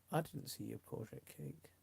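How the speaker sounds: normal talking voice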